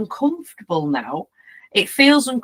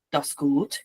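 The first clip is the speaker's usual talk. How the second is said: low, quieter German dog handler; German accent; 7 semitones lower, 6 dB quieter than usual. Retold or imitated. Dog handler in the video to viewers, German accent. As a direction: give it a light German accent. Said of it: "He's German".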